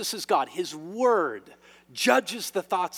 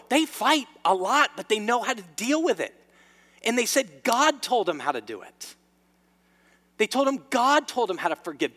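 raised, emphatic sermon delivery; fervent preaching; 5 semitones higher, 6 dB louder than usual. Acted. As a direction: preach it with conviction, driving the key words home. Preacher builds to fervent peak.

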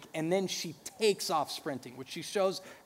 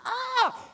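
high playful scream. Retold or imitated. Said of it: "she'll scream".

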